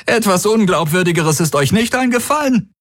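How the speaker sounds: high-pitched mocking disbelief; scornful. A narrator's reading. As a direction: jeer at the excuse, pitch right up. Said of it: "Tari lachte ihm ins Gesicht".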